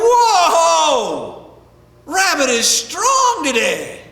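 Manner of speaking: loud, high, drawn-out whoa; startled whale. Acted. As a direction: holler in amazement as you get dragged. Whale being pulled ashore.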